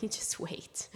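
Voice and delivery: hushed knowing whisper; sly under-breath aside; whispered warning. Retold or imitated. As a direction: whisper it like a private threat. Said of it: "I'm like".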